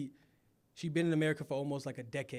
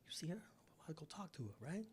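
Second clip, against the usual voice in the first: hushed whisper to a buddy; 2 semitones higher, 16 dB quieter than usual. Retold or imitated. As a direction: whisper it so she can't hear. Retold secret aside to friend at club.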